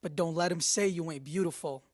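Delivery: usual speaking voice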